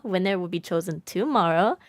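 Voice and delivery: ordinary delivery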